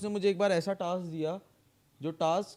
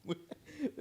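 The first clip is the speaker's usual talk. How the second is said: laugh; 12 semitones higher, 7 dB quieter than usual. Real, not acted. Laughs at own story.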